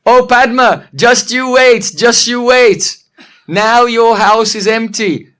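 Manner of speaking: loud, slow, drawn-out taunt; taunting threat. Acted. Old monkey answers Padma's threat, taunting. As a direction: stretch out a playful warning, loudly.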